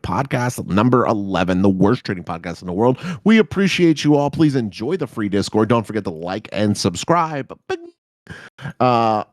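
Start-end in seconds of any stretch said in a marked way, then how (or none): none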